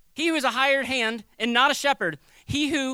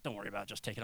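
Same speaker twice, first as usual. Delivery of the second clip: low, much softer shrugging cashier; cashier brushing it off; 12 semitones lower, 14 dB quieter, much faster than usual. Retold or imitated. Cashier waving off a wrong charge, low and soft. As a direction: toss it off quietly, no big deal. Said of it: "They're like".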